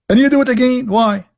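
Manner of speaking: normal talking voice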